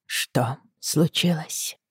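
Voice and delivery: slow soft stunned whisper. A narrator's reading. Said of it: "переспросила шёпотом". (медленным шёпотом)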